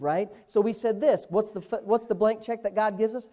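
normal talking voice

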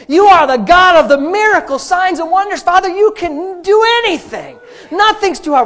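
high, loud, slow shouted preaching; preaching at full force. Acted. Pastor urging the congregation to faith, shouting. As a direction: drive every phrase home to the crowd.

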